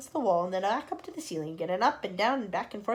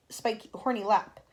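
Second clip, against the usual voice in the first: plain reading voice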